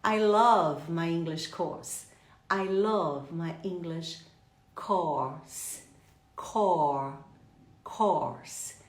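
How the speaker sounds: "course" with a long open aw sound; slow, low model of "course", vowel as in or. Shown as a demonstration. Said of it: "É um or aberto".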